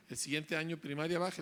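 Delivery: usual speaking voice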